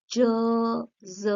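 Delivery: isolated voiced ज sound; voiced z demo. Shown as a demonstration. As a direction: buzz ज with the voice box humming. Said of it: "voiced है".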